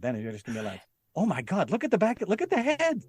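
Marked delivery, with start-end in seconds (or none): 1.2-3.0 high-pitched gawking disbelief; hammed-up astonishment, voice rising high; mock shocked outburst. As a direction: climb higher as you point out the shocking sight. Acting out shocked viewer reaction.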